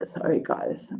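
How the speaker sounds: whispered apology, holding back tears; barely a breath, near tears; choked whisper. Real, not acted. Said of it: "Sorry, I had a moment".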